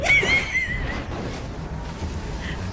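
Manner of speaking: long happy laughing; gleeful laughter; delighted laugh. Real, not acted. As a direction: laugh with pure glee.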